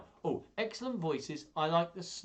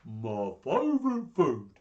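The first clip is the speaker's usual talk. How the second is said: Gruffalo monster; 6 dB louder than usual. Acted. Gruffalo eyeing his next meal, greedy monster voice. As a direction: relish it like a big beast. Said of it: "the Gruffalo said".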